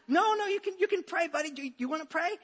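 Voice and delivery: high, coaxing parent voice. Retold or imitated. Father coaxing his young son at bedtime, high and coaxing.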